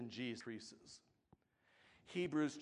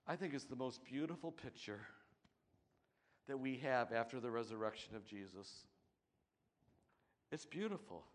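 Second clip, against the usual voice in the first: ordinary talk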